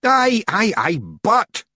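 loud, high, desperate interjection; desperate Rob Anybody. A narrator's reading. Rob struggling to win over the Feegles, desperate. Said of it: "said Rob Anybody desperately".

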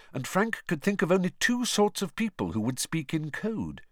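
ordinary delivery

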